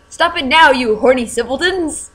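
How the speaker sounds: high, loud scolding shout. Acted. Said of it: "shouted Professor McGonagall".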